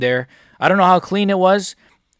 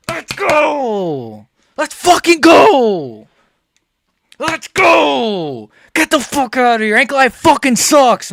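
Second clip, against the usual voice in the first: higher, tearful yelling; crying victory shout; 6 semitones higher, slower than usual. Real, not acted. Fan still yelling over Alex's win, close to tears. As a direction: celebrate with a cracking voice.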